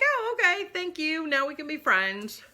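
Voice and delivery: high, sugary fake friendliness; mock-sweet tailor. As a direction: play the shady tailor suddenly all nice. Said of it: "he's like".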